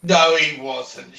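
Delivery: drawn-out, loud no; loud slow denial. Pretend. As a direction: bellow the denial slowly.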